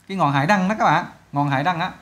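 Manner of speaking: ordinary talk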